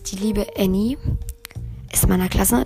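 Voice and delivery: usual speaking voice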